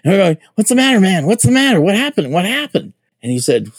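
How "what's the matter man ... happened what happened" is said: high, urgent repeated questions; alarmed questioning. Retold or imitated. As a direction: press him anxiously for what happened. Guys rushing to scared recruit.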